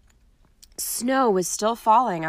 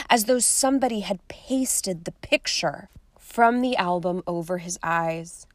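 ordinary delivery

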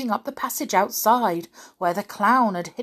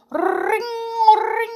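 high trilled "ring"; imitated phone ring; 5 dB louder than usual. A narrator's reading. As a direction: trill it high like a little bell. Imitates the telephone bell ringing.

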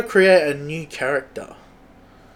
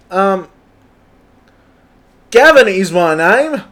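higher, louder, upbeat Aussie tradie; cheery bloke bragging about his tools and the pub; an eager tradie introduces himself to the viewers, high and loud; Gavin; 5 semitones higher, 4 dB louder than usual. Acted. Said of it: "so that's our new character, Gavin".

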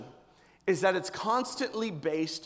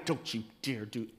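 much softer, lower warning; wife's low warning; 8 semitones lower, 8 dB quieter than usual. Retold or imitated. Wife warning husband with a look, low and soft. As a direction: threaten under your breath. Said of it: "I got the eye".

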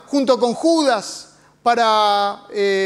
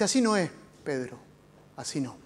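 softer, fading to a hush; gentle correction; 7 semitones lower, 7 dB quieter than usual. Acted. Jesus gently corrects Peter's violence, hushed and tender. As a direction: correct him tenderly, almost under your breath.

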